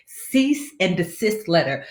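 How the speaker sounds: "Cease and desist letter" spelled out slowly, each word distinct; slow careful pronunciation. Shown as a demonstration. Clarifying the term for viewers despite her lisp, slow and careful. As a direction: say it slowly so chat catches every sound. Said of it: "I have a little bit of a lisp".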